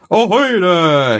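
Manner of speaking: loud, high, slow ahoy; a rabbit hailing stranded sailors; calling out. A narrator's reading. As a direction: hail them across the sea. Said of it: "he calls".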